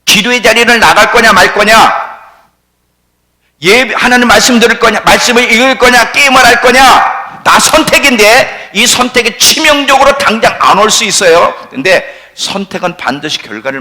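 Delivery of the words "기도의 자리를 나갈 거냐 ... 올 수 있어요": loud, high challenge to the congregation; forceful preaching. Real, not acted. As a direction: press each choice on them at full voice.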